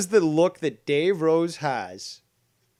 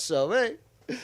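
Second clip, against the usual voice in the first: ordinary delivery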